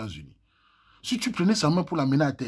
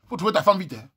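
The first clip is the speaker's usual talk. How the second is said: ordinary talk, nothing marked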